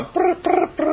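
a high, playful brr brr of a ride; light mock engine brr; riding noise. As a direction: make the sound of riding back home. Imitating the sound of riding.

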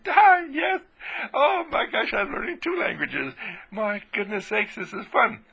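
high-pitched, delighted exclaiming; giddy excitement. Acted. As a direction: act thrilled by your own discovery. Acts out delighted beginner learning languages.

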